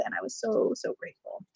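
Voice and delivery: soft, hushed heartfelt thanks; sincere whisper. Real, not acted. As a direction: breathe out real gratitude. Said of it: "thankful for the deck".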